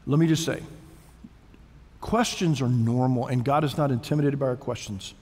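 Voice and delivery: ordinary delivery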